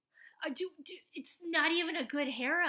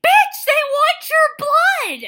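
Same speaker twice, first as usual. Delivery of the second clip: loud, high, slow warning yell; mock yell; 12 semitones higher, 18 dB louder, slower than usual. Yelling at the heroine on screen, loud mock warning. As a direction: shout the warning at her, drawn out. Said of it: "And it's like".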